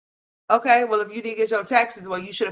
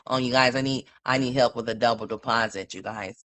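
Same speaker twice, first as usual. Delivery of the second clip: lower, mocking put-on voice of the tenant begging; mocking imitation; 11 semitones lower than usual. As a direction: mimic her plea, pitched low and fading out. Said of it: "until you said".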